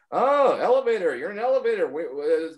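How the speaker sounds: hammed-up imitation of a comic riffing on everything; mimicking Mark Norman. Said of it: "And he goes".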